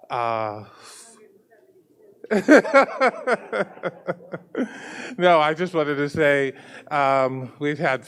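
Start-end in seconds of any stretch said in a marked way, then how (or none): 2.2-4.7 high chuckle trailing off; rapid, high-pitched giggle; laugh. Real, not acted. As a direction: laugh at your own slip. Laughs after losing track of day.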